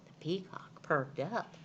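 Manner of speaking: usual speaking voice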